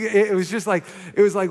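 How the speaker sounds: ordinary talk, nothing marked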